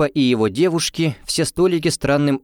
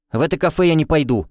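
exclaiming; 5 semitones higher, faster than usual. A narrator's reading. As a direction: blurt it out firmly. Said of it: "воскликнула Тильда".

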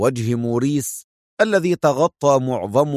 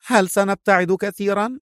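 high female character voice; curious woman's question, voiced higher; inquiring woman; 7 semitones higher than usual. A narrator's reading. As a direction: ask it lightly in a raised feminine pitch. Woman character asks the pilot.

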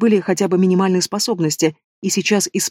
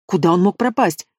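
ordinary delivery, nothing marked

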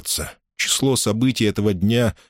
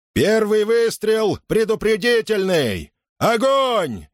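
high, drawn-out firing order; commanding cry, pitched high; sergeant's command; 13 semitones higher, slower than usual. A narrator's reading. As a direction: call out the order to the squad. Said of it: "распорядился сержант".

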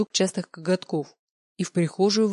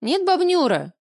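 very high loud squeak; 11 semitones higher, 5 dB louder than usual. Acted. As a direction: squeak it like a panicked girl. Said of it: "пищу я".

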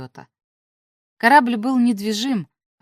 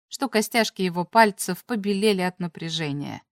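plain reading voice

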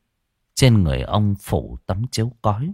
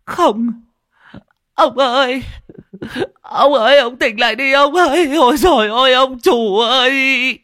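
very high, loud keening widow; frantic screaming wail; 6 dB louder than usual. Acted. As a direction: scream out in grief, pleading with the dead. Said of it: "Tiếng bà Tô gào lên thất thanh".

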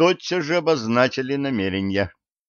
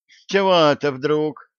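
high-pitched indignant question; affronted woman's objection; 6 semitones higher, 3 dB louder than usual. A narrator's reading. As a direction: protest with raised pitch. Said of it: "возмутилась стройно".

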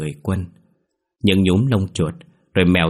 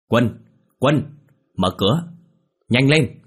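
urgent call through a locked door, higher and louder; 4 semitones higher, 3 dB louder, slower than usual. Acted. Sang banging on Quân's door, urgent shout. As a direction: pound the door and yell for Quân.

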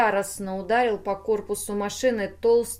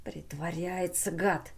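angry hiss; 2 semitones lower, 6 dB quieter than usual. A narrator's reading. Google suspects the silent machine is faking, hissing. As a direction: hiss it under the breath. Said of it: "прошипел Гугл".

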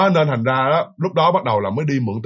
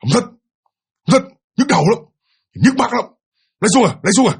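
strange, much higher, louder forced snapping; deliberately forced voice; 8 semitones higher, 4 dB louder than usual. Retold or imitated. Mother forcing out her refusal in an eerie, much higher voice. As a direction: push the words out in an odd unnatural voice. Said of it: "cố tình mẹ gằn lên vậy đó".

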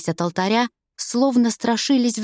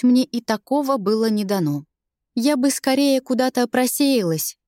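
plain reading voice

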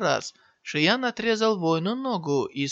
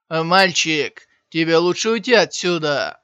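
cold warning; 8 dB louder than usual. A narrator's reading. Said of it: "холодно сказал один из воинов".

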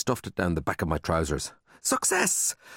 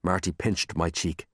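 ordinary delivery, nothing marked

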